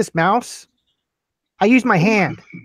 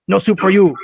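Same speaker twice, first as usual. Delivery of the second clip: louder, emphatic catchphrase delivery; punched-out comic denial; mock stern refusal; 3 semitones higher, 6 dB louder than usual. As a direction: lay down the verdict in mock severity. Quoting Amazon as a curt refuser.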